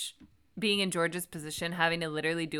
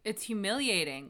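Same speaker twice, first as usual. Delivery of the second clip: ordinary talk, nothing marked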